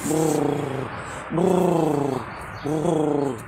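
low rolled cat purr. A narrator's reading.

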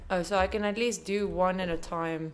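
usual speaking voice

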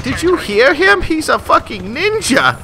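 high-pitched put-on teammate voices; mock their amazement in a high voice; awed teammates. Teammates marvel at self-declared ninja, high mock awe. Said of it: "They're like".

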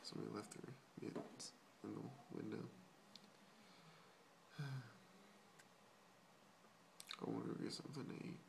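very soft, half-whispered; hushed library voice. Real, not acted. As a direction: keep it down in the library. In the library, talking low.

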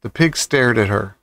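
usual speaking voice

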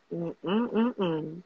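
ordinary delivery

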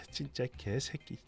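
a wife swearing to herself about her husband; muttered curse. Real, not acted. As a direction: curse under your breath.

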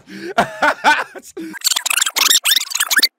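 squealing laugh, way up high; high shrieking laugh. Real, not acted. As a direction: burst into a shrill cackle. Cracking up after the rant.

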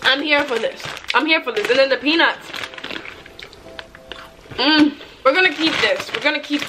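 talking while chewing snacks; muffled by food in mouth; mouth full, chewing. Real, not acted. Seen 0: Eats handfuls at 1.4 and 2.9 s, chews between phrases.

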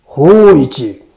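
loud, uncanny call of the name; eerie call. A narrator's reading. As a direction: call out eerily in the dark. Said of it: "またもや不気味に声が呼んだ".

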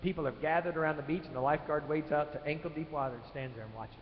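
ordinary talk

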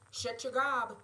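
put-on loud growl with an Irish accent. A narrator's reading. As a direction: bark it, warning him off. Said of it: "Quinn growls".

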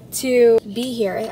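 ordinary talk

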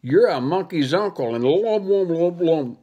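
talking with the tongue pulled; garbled speech, tongue held. Acted. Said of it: "He'd got me by the tongue".

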